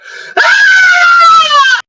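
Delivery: long, piercing high scream. Acted. Player's character terrified by the shaking bed, long loud scream. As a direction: let out one sustained, full-voiced scream. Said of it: "As you scream".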